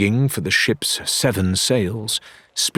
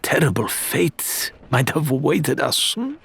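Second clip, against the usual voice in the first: higher, slow, silky-mannered captor; Faisal's smooth voice; 6 semitones higher than usual. A narrator's reading. Faisal toying with his prisoners, higher and slow. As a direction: savour each word like a gracious threat.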